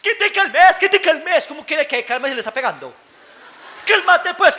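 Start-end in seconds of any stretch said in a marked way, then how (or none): 0.0-1.5 put-on higher, louder snapping at the child; mock exasperated parent yelling calm down; hammed-up impatient parent scolding. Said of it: "papás que no les tiene paciencia". 3.9-4.6 higher, louder, with a laugh; mock fed-up yell at the kid; half-laughing imitation of a losing-it parent; impatient parent. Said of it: "papás que no les tiene paciencia".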